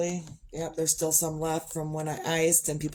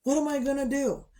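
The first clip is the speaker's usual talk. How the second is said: panicked; 8 semitones higher than usual. Retold or imitated. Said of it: "I was like".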